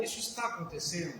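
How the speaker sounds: a quiet inner check, almost under the breath; soft self-question. Shown as a demonstration. Therapist models asking herself softly. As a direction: ask it softly, as if to yourself.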